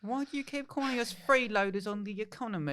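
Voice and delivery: put-on high little kid voice, hurt; child voice. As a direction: whine like a small child to dad. Kid complains to dad.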